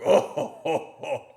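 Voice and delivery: forced high "ho ho ho" chuckle; fake laugh. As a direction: laugh it off, mock-jolly. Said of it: "We're like".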